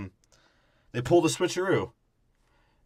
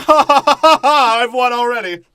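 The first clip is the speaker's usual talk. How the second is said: much higher, loud hammed-up cackle of the gloating Brit; loud mocking British officer laugh; mock villain laugh; 11 semitones higher, 13 dB louder than usual. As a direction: gloat like a cartoon villain who's already won. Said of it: "the British guy's like".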